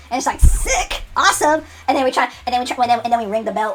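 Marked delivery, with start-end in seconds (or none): 0.4-1.6 high delighted shout; high excited cheer. Acted. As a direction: burst out happy. Celebrating joining.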